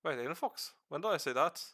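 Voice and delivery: rough put-on Irish accent held through a lore talk; streamer's half-Irish accent. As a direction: explain the lore in a loose, slipping Irish accent. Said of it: "I'm not even sure what kind of accent I'm doing right now".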